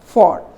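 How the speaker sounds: "for" drawn out with full O; over-clear "for", the sound to avoid; wrong sound. Shown as a demonstration. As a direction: stretch "for" as a long FAWR. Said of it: "Don't use".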